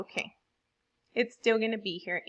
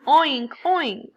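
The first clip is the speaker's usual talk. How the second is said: loud, higher-pitched oinks; sound-effect word; 4 semitones higher, 7 dB louder than usual. Shown as a demonstration. Teacher giving class examples of onomatopoeia, loud imitated oinks. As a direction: imitate a pig's noise in words. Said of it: "that imitate sounds".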